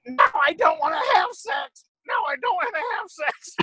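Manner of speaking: high-pitched squawky bird impersonation; mockingbird voice. Man imitates the bird keeping him up, high mockingbird voice. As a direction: cry it out high, as a comic bird. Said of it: "it kept saying".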